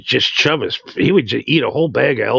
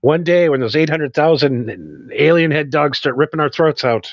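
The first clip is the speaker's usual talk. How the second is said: ordinary talk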